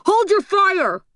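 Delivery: high, loud commanding scream; screaming order. A narrator's reading. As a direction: scream to stop the shooting. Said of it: "Anita screams".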